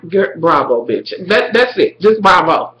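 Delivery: usual speaking voice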